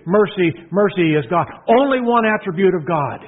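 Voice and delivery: ordinary delivery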